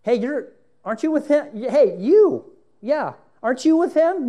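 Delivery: put-on high, loud, insistent servant girl; a servant girl calls out Peter, high and loud; accusing girl. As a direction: point him out, high and loud.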